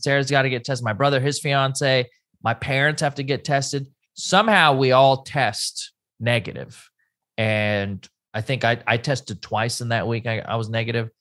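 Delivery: ordinary talk, nothing marked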